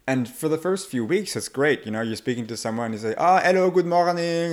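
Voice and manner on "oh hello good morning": put-on high, cheery French-accented greeting; lilting French newcomer, pitched up. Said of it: "a lot of our clients are French".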